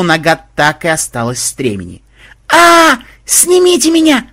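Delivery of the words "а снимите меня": very high, loud cry for rescue; panicked scream. Acted. Mahmud dragged by his stirrup, panicked high scream. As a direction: scream to be taken down.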